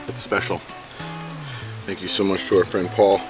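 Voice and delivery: usual speaking voice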